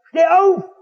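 put-on sharp high shouted command at a little boy; father yells at his bound five-year-old, shouting; retold shout. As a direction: bark it out like a drunk father yelling at his son. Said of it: "schreit er seinen kleinen Fünfjährigen an".

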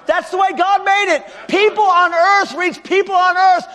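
"People" shouted high and loud; shouted repetition. Acted. Pastor repeating the principle to the crowd, shouted high. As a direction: hammer "People on earth" home at full voice.

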